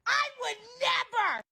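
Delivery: high drawn-out refusal. Acted. Repeat, higher and slower.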